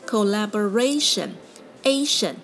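collaboration with a strong "RA" before "tion"; collaboration, main beat on "ra"; stress before -tion. Shown as a demonstration. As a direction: punch the "a" of ation. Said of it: "会把重音拉到T I O N的前一个音节".

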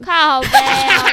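loud, drawn-out cry of disbelief; loud shocked exclamation at a friend's prank; shocked outburst. Real, not acted. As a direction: blurt it out stunned and loud. Reacts to the cruel prank.

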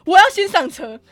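loud, high insistence; loud demand. Retold or imitated. To the station staff, loud firm demand.

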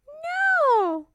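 put-on very high little-girl voice; little princess refusing. Princess answering the king about water, high-voiced refusal. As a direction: refuse in a squeaky child character voice.

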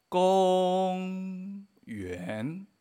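公 sostenido alto y plano, 园 ascendente; primer tono alto. Shown as a demonstration. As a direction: di 公 largo y plano arriba, 园 subiendo. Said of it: "alto y plano". (tonos marcados despacio en 公园)

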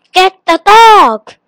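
loud, high shouted command; calling out. A narrator's reading. The genie commands the bird, calling out loudly. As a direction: call the order out loudly. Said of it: "he called".

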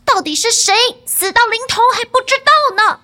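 high, loud taunting shout. A narrator's reading. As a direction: throw the threat back at him. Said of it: "儿子大叫着". (高声叫着反击)

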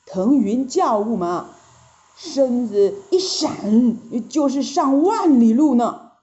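usual speaking voice

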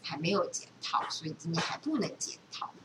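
usual speaking voice

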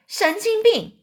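high-pitched scornful exclamation; jeering insult. Acted. Someone jeers at the boy's plan.

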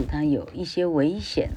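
ordinary delivery

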